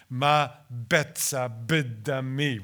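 "Ma betza bidami" with the B and D sounds brought out; alliteration shown. Shown as a demonstration. Translator showing listeners the alliteration in the Hebrew. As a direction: lean on the B and D sounds. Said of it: "both the B sound and the D sound".

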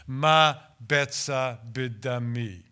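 "Ma betza bidami" slow, each syllable in Hebrew rhythm; Hebrew recited. Shown as a demonstration. Translator showing the class how the Hebrew sounds, slow recitation. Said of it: "in the Hebrew sounds like this".

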